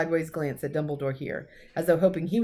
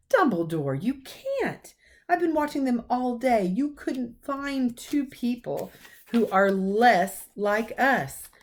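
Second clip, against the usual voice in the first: lightly voiced professor, protesting higher; McGonagall; 7 semitones higher, 3 dB louder, slower than usual. Professor protesting to Dumbledore about the Dursleys, mildly raised. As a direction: object in alarm, stern teacher.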